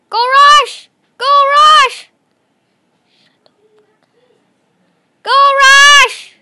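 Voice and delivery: loud, high cheering shout; rally at full volume. Real, not acted. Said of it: "I don't know why I'm shouting".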